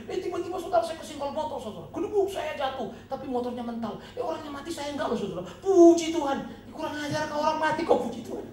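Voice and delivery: loud, high, laughing mock testimony; fiery preaching. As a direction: belt out the story with scornful glee. Preaching at full force.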